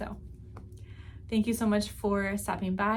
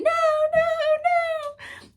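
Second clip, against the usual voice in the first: high, drawn-out mock wail; retold freak-out; 7 dB louder, slower than usual. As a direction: act out a panicked no. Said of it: "freaking out, saying".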